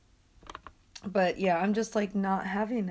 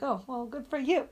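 sarcastic; 5 semitones higher than usual. Retold or imitated. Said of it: "I was like".

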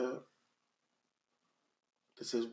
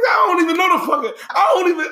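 mock bewildered; 11 semitones higher, 3 dB louder than usual. Real, not acted. Mock confusion about Mulan songs.

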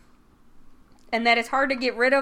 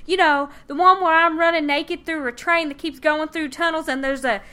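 put-on Southern Belle accent; 6 semitones higher, 5 dB louder than usual. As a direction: gush it out in one breath, higher Southern Belle. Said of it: "Like, that's how she says it".

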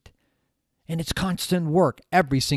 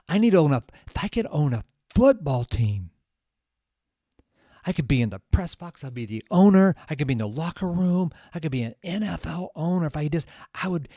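higher, excited mock daydream; imitated eager dreaming; 5 semitones higher, 5 dB louder than usual. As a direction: act out someone carried away by a big wish. Acts out Ken dreaming of ownership.

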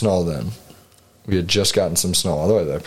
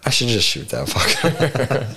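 slow words breaking into a laugh; laughing while joking about the odd buck; 4 semitones higher, slower than usual. Retold or imitated. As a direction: say it half laughing at the idea. Jokes about shooting ugly buck.

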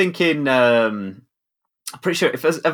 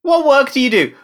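incredulous; 11 semitones higher, 4 dB louder than usual. Real, not acted. Incredulous challenge to host.

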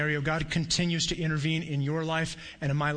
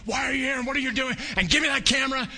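retold angry shouting; 9 semitones higher than usual. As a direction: bark the demands like the furious guard. Enraged guard confronts the speaker.

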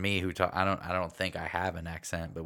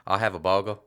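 imitated mountain accent, loud; mock Appalachian mountain speech; mountain dialect; 8 dB louder than usual. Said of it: "like, the mountain dialect".

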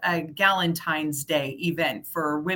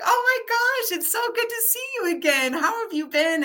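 much higher, gushing delight; thrilled to see her again; excited reunion; 12 semitones higher than usual. Retold or imitated. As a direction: squeal of joy at seeing a friend. Reunion with the woman at book study.

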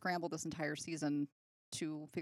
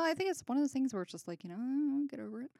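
dismissive mock; 7 semitones higher, 7 dB louder than usual. Mocking dismissive friends.

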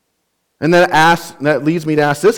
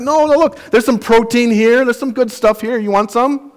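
high-pitched, eager mock sales pitch; coaxing offer; 9 semitones higher than usual. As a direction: coax the kids in a high voice. Retold coaxing offer to kids.

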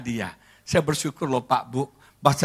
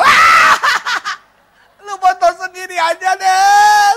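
wife's furious laughing shout; 11 dB louder, slower than usual. Retold or imitated. Wife scolds his bad photos.

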